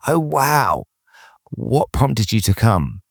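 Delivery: impressed "oh, wow", then a slowed question; curious. Acted. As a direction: show genuine interest in their answer. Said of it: "And be curious".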